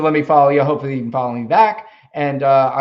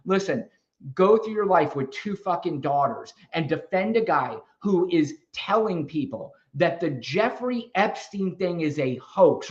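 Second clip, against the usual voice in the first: usual speaking voice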